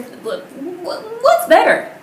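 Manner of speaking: high, loud what's better; outraged disbelief, pitch shooting up; shocked yell; incredulous. Real, not acted. Incredulous reaction to quote.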